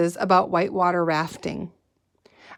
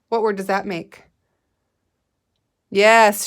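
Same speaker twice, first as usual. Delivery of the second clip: ordinary delivery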